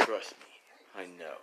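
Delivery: hushed, secretive whisper. Retold or imitated. Mike telling his friends he knows, whispered. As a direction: drop to a breathy secret.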